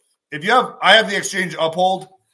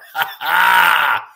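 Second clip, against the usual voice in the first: loud burst of laughter; 7 dB louder than usual. Real, not acted. Laughing at his joke.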